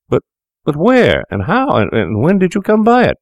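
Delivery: high, stammered cry of shock; stammering cry. Acted. Fettes cries out to Macfarlane, high stammering shock. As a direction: blurt it out in stunned disbelief. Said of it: "cried the other".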